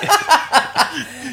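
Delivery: sudden high squeal of laughter. Real, not acted. Laughing before telling the worst part, high laugh. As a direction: burst out in a high giggle. Laughs at his own story.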